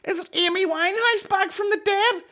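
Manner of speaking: very high, loud, incredulous guessing; hammed-up falsetto suspense; mock excitement. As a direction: squeal it like a hysterical panellist. Mimics excited TV show guessing.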